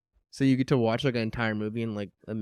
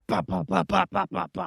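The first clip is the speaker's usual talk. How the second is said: knocking sounds; 5 semitones higher than usual. Retold or imitated. Imitating knocking on door.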